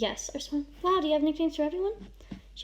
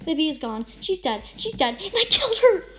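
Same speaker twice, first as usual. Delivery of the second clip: anguished outburst; 5 semitones higher, 8 dB louder than usual. A narrator's reading. Grief-stricken confession of a killing.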